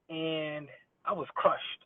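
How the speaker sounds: slow, sinking sadness; a mock-sad slow sigh. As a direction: let it drag, heartbroken.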